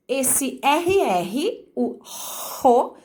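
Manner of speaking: "R R" drawn out slowly as one rolled sound. Shown as a demonstration. Teacher sounding the RR digraph for students. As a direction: stretch "ro" into a single guttural R. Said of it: "Quando eu falo a palavra carro".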